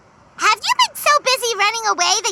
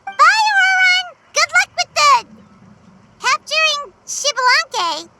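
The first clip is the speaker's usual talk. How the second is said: calling out goodbye; 8 semitones higher than usual. Acted. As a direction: shout it after him as he goes. Paimon calls out a goodbye.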